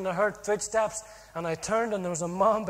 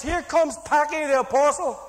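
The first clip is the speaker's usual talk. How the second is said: higher, louder teasing call across the yard; mock shout; 5 semitones higher, 5 dB louder than usual. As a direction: jeer at him as he walks out the door. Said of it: "and all shouts".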